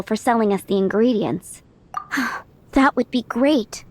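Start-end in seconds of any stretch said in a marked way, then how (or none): 1.9-2.4 weary sigh. Acted. Restaurant owner's troubles.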